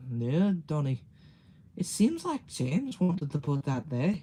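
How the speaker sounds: Scottish engine voice, lively swoops in pitch; Donald and Douglas. Shown as a demonstration. Streamer demonstrating the twin engines, Scottish accent. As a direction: play it with a put-on Scottish accent. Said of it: "Here's my Donald Douglas".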